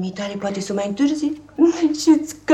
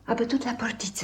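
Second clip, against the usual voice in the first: hushed whisper; nanny whispering that someone is at the gate; 3 semitones higher, 7 dB quieter, faster than usual. Acted. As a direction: whisper it as if listening. Hearing mother at the gate.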